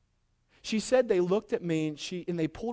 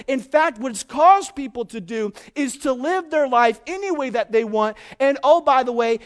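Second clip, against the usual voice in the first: louder, higher, driving sermon delivery; full-force preaching; 6 semitones higher, 8 dB louder than usual. Acted. Preacher urging the congregation to surrender everything, at full force.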